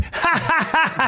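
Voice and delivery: high, hammed-up sitcom laugh; mocking high laugh. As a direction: ape his dumb laughter up high. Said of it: "some terrible sitcom going".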